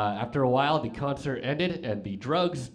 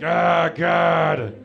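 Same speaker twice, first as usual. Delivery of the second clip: loud, higher cry of dismay; horrified outburst; 4 semitones higher, 7 dB louder than usual. Retold or imitated. Tripping guy hearing about his hands, loudly alarmed. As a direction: blurt it in alarm.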